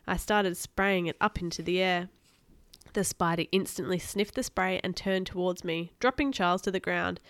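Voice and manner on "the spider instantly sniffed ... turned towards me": usual speaking voice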